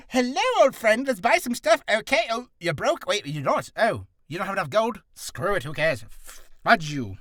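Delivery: high, loud greeting sinking into letdown; hammed-up chummy shopkeeper turning sour; shop merchant. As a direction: open over-friendly, then give up on them. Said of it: "And then the third one is".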